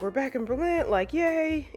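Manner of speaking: high-pitched retold cheer; rising to a high little yay; excited. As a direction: lightly imitate an excited homecoming. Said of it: "everybody's excited".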